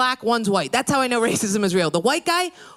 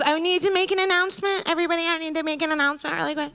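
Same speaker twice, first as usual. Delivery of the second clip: mock announcement voice; 7 semitones higher, faster than usual. Voicing the head witch.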